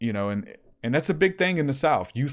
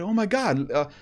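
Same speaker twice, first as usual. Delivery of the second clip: higher, starstruck gushing; excited kid; 6 semitones higher than usual. Retold or imitated. Retelling Levi thrilled the preacher called in, excited. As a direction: gush like a thrilled fan. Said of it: "he gets super excited".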